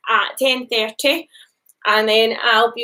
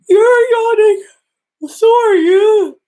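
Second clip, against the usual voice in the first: higher, louder playful accusation; loud teasing; 9 semitones higher, 7 dB louder than usual. Acted. Story children teasing each other about yawning, loud and playful. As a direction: call it out like a cheeky kid.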